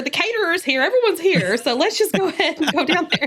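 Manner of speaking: high-pitched, amused retelling of coaxing; retold coaxing. Planner recalls urging bride down the aisle, high-pitched coaxing. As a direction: pitch it well up, playfully imitating your own plea.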